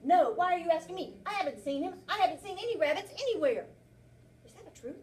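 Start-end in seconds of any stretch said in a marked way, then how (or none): 0.0-3.7 louder, higher, nervous denial; defensive bear. Acted. Teacher rereading the bear's lie to the class: loud defensive. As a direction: protest too much, a guilty liar. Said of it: "He says".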